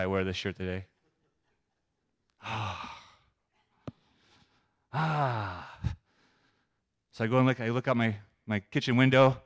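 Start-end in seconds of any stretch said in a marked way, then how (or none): 4.9-5.8 long, reluctant exhale; heavy sigh. Acted. Preacher recalls being convicted by God, heavy sigh. Sighs after recalling the rebuke.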